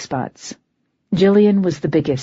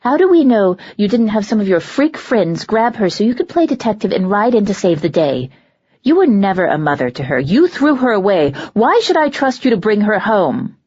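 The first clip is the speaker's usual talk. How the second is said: louder, fast, accusing; bitter accusation; 3 semitones higher, 4 dB louder than usual. Acted. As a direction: lash out with bitter blame.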